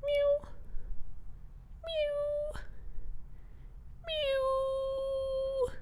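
tiny, high, soft kitten mews stretching longer; thin, drawn-out, pleading meows; whiny cat meow. Acted. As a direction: mewl like a pitiful stray cat. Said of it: "what does he sound like?".

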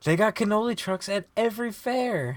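high-pitched, indignant comeback; heated rebuttal. Real, not acted. Co-host contesting cannoli trucks at fairs, indignant. Friends' heated food argument.

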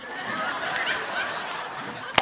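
soft airless wheeze of laughter; breathless laugh. Real, not acted. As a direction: laugh silently at the joke. Laughing at own punchline.

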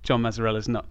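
ordinary talk, nothing marked

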